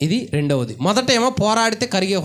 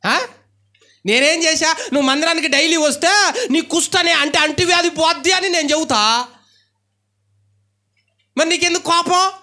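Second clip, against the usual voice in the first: indignant raised voice; 5 semitones higher than usual. Retold or imitated. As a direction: press the question home, indignant. Preacher voicing prophet, raised. (ఆగ్రహంతో ఎత్తైన గొంతు)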